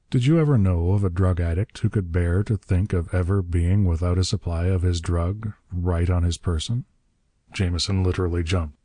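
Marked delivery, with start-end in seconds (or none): none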